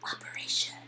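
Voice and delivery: whispered like a spy on a mission. Pretend. [name] briefing herself on the outfit mission, spy whisper. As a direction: hush it like covert ops.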